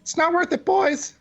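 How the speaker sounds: high-pitched intimidating shout at the guards; intimidating yell. Acted. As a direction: bark it at them like a gunslinger. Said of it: "yell at them like".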